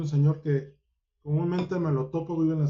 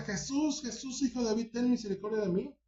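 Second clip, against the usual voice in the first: blind man crying out; 6 semitones higher, 4 dB quieter than usual. Retold or imitated. Blind Bartimeo calls to Jesus for mercy, high pleading call. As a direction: plead upward as if across a crowd. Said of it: "el ciego Bartimeo le grita".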